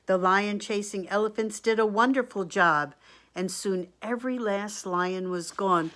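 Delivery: projected, animated story reading; storybook read-aloud. A narrator's reading. Reader to children about the king and his wise men, storybook read-aloud. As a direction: read it out clearly for little listeners.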